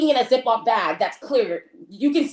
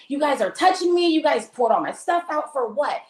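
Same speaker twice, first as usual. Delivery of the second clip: high-pitched outraged protest; indignant, higher-voiced rant; retold indignant complaint; 6 semitones higher than usual. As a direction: act out complaining at them, pitched up. Retold angry complaint on the phone.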